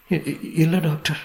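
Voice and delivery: high, halting stammer; weary denial. A narrator's reading. Mother denying it to the doctor, weary high stammer. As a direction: stammer it out slowly, worn down. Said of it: "என்றாள் அலுப்புடன்".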